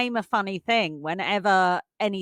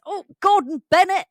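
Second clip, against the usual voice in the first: exclaimed higher and louder, mock exasperation; dad's exasperated catchphrase; 11 semitones higher, 7 dB louder than usual. Daughter mimics dad when anything goes wrong, mock exasperation. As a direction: imitate dad's stock curse, pitched up and loud. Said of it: "he always just goes".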